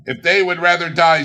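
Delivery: scornful Scrooge. Acted. Scrooge mocking the charity gentlemen, scornful and loud. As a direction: sneer it like a heartless miser.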